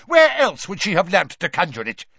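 loud, high, angry demand; shouting Mister Crouch. A narrator's reading. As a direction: hurl the question angrily. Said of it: "shouted Mister Crouch".

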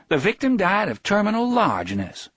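high-pitched mock pronouncement; affectionate teasing. A narrator's reading. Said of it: "The words were nagging, the tone was not".

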